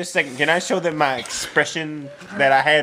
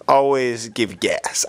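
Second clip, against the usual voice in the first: ordinary delivery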